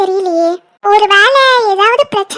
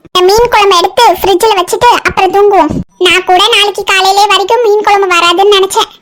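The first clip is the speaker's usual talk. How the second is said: ordinary talk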